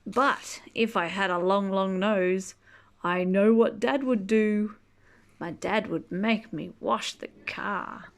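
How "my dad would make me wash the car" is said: plain reading voice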